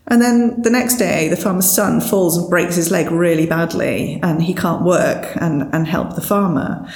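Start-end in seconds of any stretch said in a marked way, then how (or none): none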